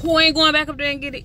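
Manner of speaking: high loud exasperation; fed up rhetorical question; exasperated. Real, not acted. Exasperated exclamation.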